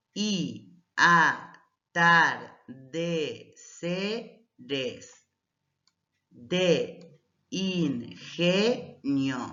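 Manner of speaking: each syllable on its own, with a gap after it; slow syllable-by-syllable reading; split into syllables. Shown as a demonstration. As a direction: say "y a-tar-de-ce-res de in-ge-nio" piece by piece. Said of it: "separamos en sílabas de manera gramatical".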